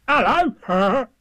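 high, booming, bouncy call; Tigger's loud hello. Acted. Tigger suddenly calls from close by, loud high hello.

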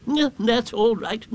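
high, slow owl voice; Owl agreeing. A narrator's reading. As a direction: reassure him as a ponderous owl.

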